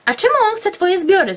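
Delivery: curious question. Acted. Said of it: "zapytałam z ciekawości".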